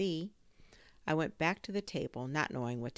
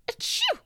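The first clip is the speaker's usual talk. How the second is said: sharp high sneeze; loud sneeze; 9 dB louder than usual. A narrator's reading. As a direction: sneeze loudly. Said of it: "answered me with a sneeze".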